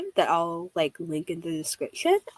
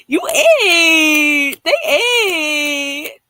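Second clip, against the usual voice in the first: high, loud, laughing and teary; ecstatic praise; 7 semitones higher, 10 dB louder, much slower than usual. Real, not acted. Streamer praising the makers, laughing shout. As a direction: gush through laughter and tears.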